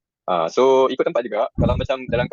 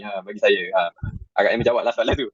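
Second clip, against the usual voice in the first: ordinary talk, nothing marked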